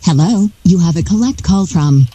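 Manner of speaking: ordinary talk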